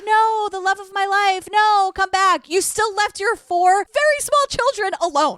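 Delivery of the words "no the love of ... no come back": hammy begging lover; dramatic pleading husband. Retold or imitated. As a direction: mock-desperate plea, pitched up. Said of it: "Drew was like".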